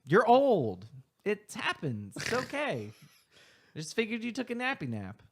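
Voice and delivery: high, loud, fast nervous rambling; high nervous excuse. Acted. NPC excuses himself to elder.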